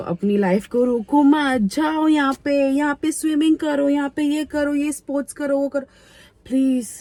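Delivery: imitated advert exhortation, high; mocking ad voice. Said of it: "कौन ये ऐड करता है यार".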